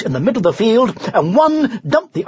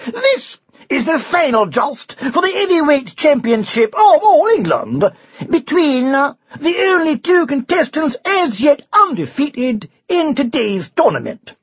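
high, loud ringside announcer; royal herald; 9 semitones higher, 3 dB louder than usual. A narrator's reading. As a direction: announce like a boxing MC. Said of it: "shouted the herald".

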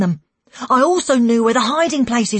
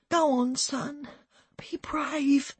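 higher, hushed encouragement; tearful goodbye; 4 semitones higher, 8 dB quieter, much slower than usual. A narrator's reading. Mother sending her son off, hushed and tearful. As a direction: urge him on in a near whisper.

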